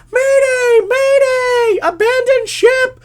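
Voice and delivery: high, full-blast panicked scream. Retold or imitated. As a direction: scream the alarm like a trapped kid. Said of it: "he was screaming".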